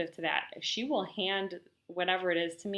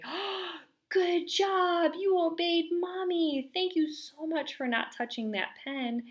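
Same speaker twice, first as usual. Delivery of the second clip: put-on high sing-song praise for a toddler; praising mom; 10 semitones higher than usual. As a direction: gush over the child in a bright, high voice.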